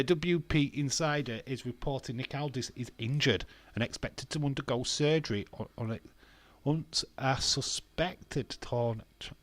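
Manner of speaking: ordinary delivery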